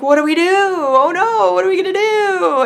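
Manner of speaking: high, loud panicked wail, hammed-up; mock panic. Mom retelling her panic in a failing car, panicked wail. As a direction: wail it in rising, exaggerated panic.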